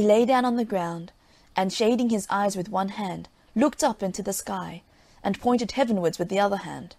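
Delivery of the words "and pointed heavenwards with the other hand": ordinary delivery, nothing marked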